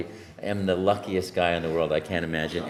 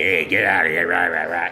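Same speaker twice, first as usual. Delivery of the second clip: mock Brooklyn grouch; 4 semitones lower, 6 dB louder than usual. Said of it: "it's just not my character".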